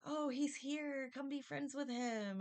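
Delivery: high, slow sugary coaxing; imitating her sweet push, drawn out and high; mimicking sister. Sister coaxing speaker to befriend her man, mock sweet. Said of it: "and she's trying to".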